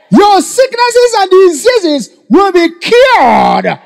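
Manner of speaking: high, ringing preacher's declarations; stretched, high-pitched sermon cries; full-force proclamation. Real, not acted. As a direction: declare each promise at a shout.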